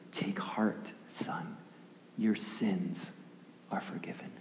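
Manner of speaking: soft, near-whispered comfort; hushed, unhurried forgiveness; gentle reassurance. Acted. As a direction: speak tenderly and very quietly, pausing between phrases. Jesus gently forgives paralyzed man.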